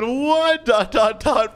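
high-pitched frightened yelp, acted; scared kid. Kid hearing kidnapper's death threat, acted scared. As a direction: voice a kid's alarm, high and startled. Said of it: "this kid is so scared".

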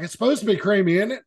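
retold loud, pointed question; pretty loud. Diner answering the waitress so everybody around hears, loud and pointed. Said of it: "in pretty loud voices where everybody around us can hear".

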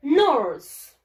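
"Nurse" loud and drawn out, falling; model pronunciation. Shown as a demonstration. Teacher giving pupils a word to repeat, "Nurse" drawn out loudly. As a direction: model "Nurse" as one long English syllable, loudly. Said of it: "Repeat after me".

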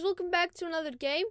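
ordinary talk

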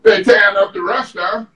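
ordinary delivery

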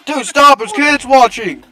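frantic high yell; panicking gamer. Real, not acted. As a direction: shout at him to stop. Panicked shout at teammate.

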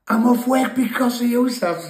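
high, loud mocking whine; whiny complaint. Injured mate blaming him for lost work, loud mock whine. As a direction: whine like a hard-done-by mate.

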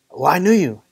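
acted indignant protest. Churchgoer answering God on judgment day, indignant protest. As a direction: object as if wrongly turned away.

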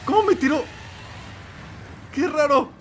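high, sudden cry of disbelief; can't believe he got knocked down; shocked disbelief. Real, not acted. Knocked down unexpectedly.

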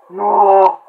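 loud, drawn-out gamer's yell; dismayed shout. Real, not acted. Gamer calling out to Ronald McDonald, dismayed shout. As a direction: bellow in dismay at the character.

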